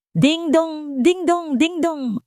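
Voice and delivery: high loud imitated ding dong; hurried doorbell. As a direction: ring it like an impatient doorbell. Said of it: "sunod-sunod at nagmamadaling tunog ng doorbell".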